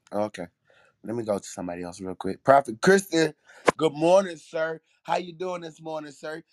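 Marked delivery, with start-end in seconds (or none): 2.4-6.4 calling out greeting. Real, not acted. Host calling out a guest.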